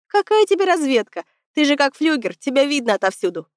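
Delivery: mocking tease. A narrator's reading. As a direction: rib him with mock disbelief. Cadets mock a tall boy. (насмешливо поддразнивая)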